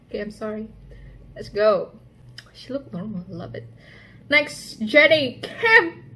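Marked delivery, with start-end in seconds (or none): 4.3-6.1 excited. Real, not acted. Announcing next member excitedly.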